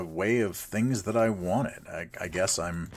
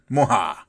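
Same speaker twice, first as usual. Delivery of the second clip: short, loud mock evil cackle; evil villain laugh; 7 dB louder than usual. Villain laugh after plotting theft.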